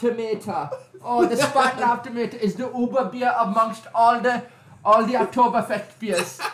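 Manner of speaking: high laughing mock German accent. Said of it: "do it in your creepy German accent".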